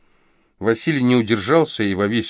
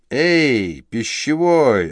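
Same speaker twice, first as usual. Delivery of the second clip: calling out; 5 semitones higher, 3 dB louder, much slower than usual. Acted. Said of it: "окликнул молодчик".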